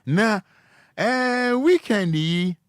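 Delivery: sudden high, loud start, slowing right down; excited burst then a slow drawl; excited restart. Real, not acted. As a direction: jump up high and drag the word out. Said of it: "sudden high loud restart".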